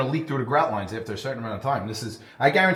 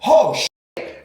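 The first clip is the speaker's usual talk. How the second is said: much higher, much louder startled curse; shocked shout; 11 semitones higher, 9 dB louder than usual. Retold or imitated. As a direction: yell it on seeing your own blood. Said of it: "He's like".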